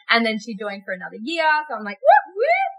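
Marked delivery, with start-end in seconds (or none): 2.0-2.7 high, loud double whoop; playful retold cheer; celebratory whoop. Celebrating the client renewing.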